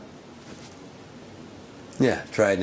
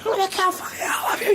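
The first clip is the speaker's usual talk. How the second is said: hammed-up shrieking threat; mock shout; 3 dB quieter, faster than usual. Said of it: "He just runs out of breath".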